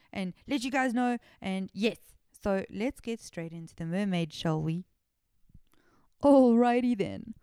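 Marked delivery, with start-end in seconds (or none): 6.2-7.3 loud, slow, stretched announcer line; hammed-up announcer opener; intro launch. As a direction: kick off the segment like a host.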